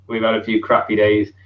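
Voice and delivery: usual speaking voice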